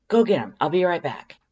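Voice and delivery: loud, high, eager command; bossy Undyne. A narrator's reading. Undyne orders the human to fetch decorations, loud and eager. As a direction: bark it out like a coach on game day. Undyne bossing, then rushing off.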